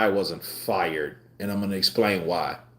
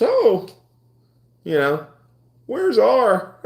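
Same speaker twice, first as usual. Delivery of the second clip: high mock announcer calling out; mock store page; 13 semitones higher, 3 dB louder, slower than usual. Imitating a store worker looking for him, high mock call. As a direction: pitch it up like a store clerk paging. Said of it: "I guess that stands for Robert".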